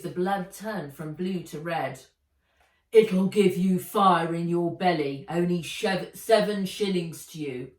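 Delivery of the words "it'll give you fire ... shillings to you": put-on loud, pushy old peddler; gruff old man. Old man selling the vial to Nat, gruff and pushy. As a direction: wheedle her into buying. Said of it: "He waved the vial again".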